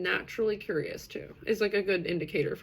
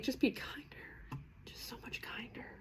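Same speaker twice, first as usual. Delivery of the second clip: hushed, whispered appeal; whispered plea; 5 semitones higher, 13 dB quieter than usual. Real, not acted. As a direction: plead softly, almost all breath.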